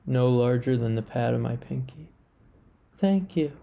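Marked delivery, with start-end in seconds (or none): 3.0-3.5 high, small thank you; a small creature's grateful squeak; tiny germ voice. A narrator's reading. The rescued germ thanking the narrator in a high little voice.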